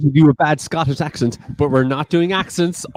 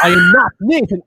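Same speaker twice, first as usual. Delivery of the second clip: mock outraged shout; 10 semitones higher, 6 dB louder than usual.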